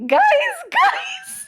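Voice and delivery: high, laughing call of guys; disbelieving cry of guys. Retold or imitated. Retelling being snubbed to friends, laughing high exclamation.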